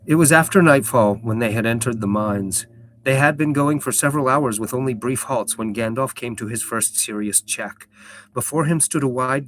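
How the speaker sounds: steady storyteller reading aloud; story narration. A narrator's reading. Streamer reading the book to her chat, storyteller voice. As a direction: read it like a fantasy audiobook.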